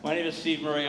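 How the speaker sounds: normal talking voice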